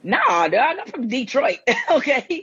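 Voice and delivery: high, laughing denial; playful protest. Real, not acted. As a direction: insist with a laugh. Guest protests being from Inkster.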